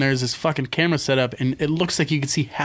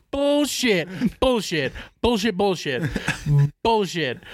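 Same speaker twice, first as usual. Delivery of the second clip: higher-pitched, repeated scoffing; scornful disbelief; 4 semitones higher, slower than usual. Retold or imitated. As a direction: dismiss it over and over, mock-incredulous. Repeatedly scoffing at staged show.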